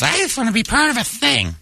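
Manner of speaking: very high, put-on fan voice; squeaky mock bandwagon fan; mocking eager fans. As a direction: mimic a needy fan in a silly high voice. Mocks fans buying tickets early.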